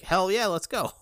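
high, loud, all-in acceptance; excited yell. Retold or imitated. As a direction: shout it like you jumped at the offer. Acts out his eager reply.

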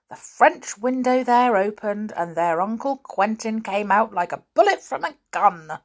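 ordinary delivery, nothing marked

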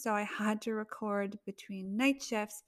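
ordinary delivery

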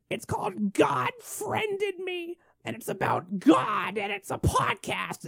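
put-on high, slow old lady with a New England accent, rising to a shrill yelp; old aunt's New England voice. Son mocking his great aunt pitching her show, high slow old lady voice. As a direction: squeak it out like a fussy great aunt. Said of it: "an old woman with, uh, you know, a New England accent".

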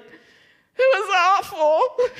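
high, slow, laughing whine; mock wail. Wife telling husband about her loss, in a drawn-out mock whine. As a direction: drag out the moan for comic effect.

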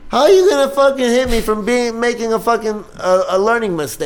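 high-pitched, incredulous complaint, easing down at the end; indignant protest. Acted. Son arguing back at a threatening parent, indignant.

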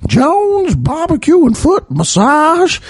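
very high, drawn-out hawker's cry; imitated commercial pitchman. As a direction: belt out the slogan in a silly high voice. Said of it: "the man with the dinosaur and the chicken going".

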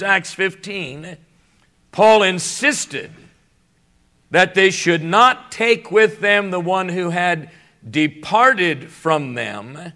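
usual speaking voice

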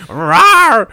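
loud, high playful dino roar; dinosaur roar. Pretend. Friend playing with his food, roaring like a dinosaur. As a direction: roar like a toy dinosaur at the table.

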